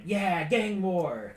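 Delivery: high, slow thrilled whoop; gleeful cheer. Retold or imitated. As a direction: cheer like it's a party. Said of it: "Mira heard it and went".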